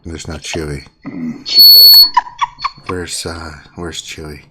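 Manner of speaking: low creepy stalker voice; heavy breathing phone whisper. Acted. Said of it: "He's doing his stalker voice".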